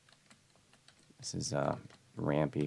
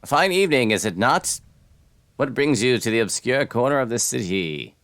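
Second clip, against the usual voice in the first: high, loud theatrical townsperson; lofty game-character voice, pitched up; wandering stranger; 7 semitones higher, 7 dB louder than usual. Acted. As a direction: greet the traveler in a big, raised character voice.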